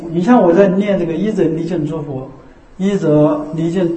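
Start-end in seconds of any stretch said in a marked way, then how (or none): 2.8-4.0 一则离境 said slowly, each syllable apart; slow recitation. Shown as a demonstration. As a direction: drag out 一则离境 unhurriedly. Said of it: "其实要念慢一点".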